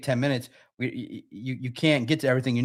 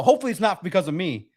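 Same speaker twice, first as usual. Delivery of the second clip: ordinary talk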